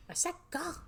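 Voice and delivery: put-on high, sharp teen yell; teen snapping. Teen girl ordering her friend to go. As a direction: shove her away with it.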